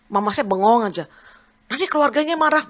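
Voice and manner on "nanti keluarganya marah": high, louder retold warning; worried mother. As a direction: imitate a fretting mother, pitch jumping up. Mother worries the family will object.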